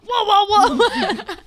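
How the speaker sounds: high, loud, giggling whoa; laughing mock whoa.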